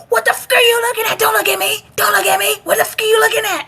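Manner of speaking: very high, rapid-fire mock challenge; imitated yelling. Instructor close up to a staring recruit, imitated yelling. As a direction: berate like an instructor right in someone's face. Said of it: "when they're yelling at you".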